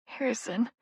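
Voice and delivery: soft, tearful whimper of his name. Acted. Sister whimpering her twin brother's name, softly. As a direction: whimper to your twin, near tears. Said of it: "I whimper".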